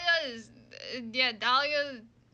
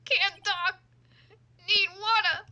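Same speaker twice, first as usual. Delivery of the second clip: raised, strained begging; choked pleading; 5 semitones higher, 5 dB louder, slower than usual. Acted. Terry too overcome to testify, strained pleading. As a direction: squeeze the words out desperately. Terry begs, unable to talk.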